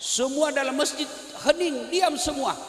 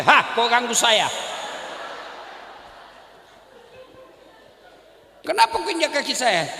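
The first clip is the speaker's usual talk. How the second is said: louder indignant comeback; indignant retort; 4 dB louder than usual. Retold or imitated. Preacher retorting to the man who complained about his foot, louder and indignant. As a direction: throw the question back, annoyed.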